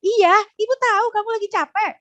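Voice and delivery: high-pitched rushed version that doesn't land; high fast voice. Shown as a demonstration. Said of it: "Dia nggak, nggak nyentuh".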